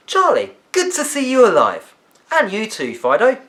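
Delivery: put-on a lively girl greeting her two friends, high and loud; lively girl's voice. A narrator's reading. Olivia greets the boys.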